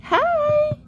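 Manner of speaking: very high, thrilled little squeal; delighted greeting. Real, not acted. Amazed a bird flew right in, delighted greeting. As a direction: squeal hello to a cute animal.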